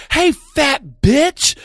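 high, slow, drawn-out call; queen insulting a heckler over the microphone, high and drawn out; mic insult. Retold or imitated. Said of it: "I said".

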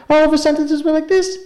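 Sugarcane. Retold or imitated. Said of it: "In Sugarcane's talking head".

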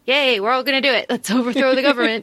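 quick, modest cheer in an everyday pitch; going along cheer. Retold or imitated. Relieved soldiers follow the first one's cheer, mildly eager. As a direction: join in hastily, only mildly enthusiastic.

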